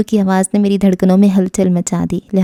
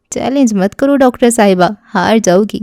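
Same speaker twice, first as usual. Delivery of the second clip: plain reading voice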